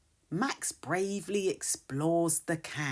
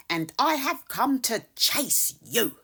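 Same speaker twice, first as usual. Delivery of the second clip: ordinary delivery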